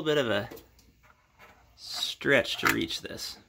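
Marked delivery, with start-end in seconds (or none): none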